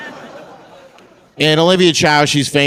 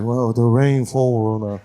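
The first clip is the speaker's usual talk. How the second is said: put-on slow caricatured Chinese accent; drawn-out put-on Chinese accent; Olivia Chow; slower than usual. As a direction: explain it slowly in a mock Chinese accent. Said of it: "She's like".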